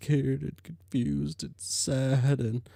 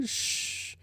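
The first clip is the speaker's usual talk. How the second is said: hushing; 6 dB quieter than usual. Acted. Hushing crying Jade.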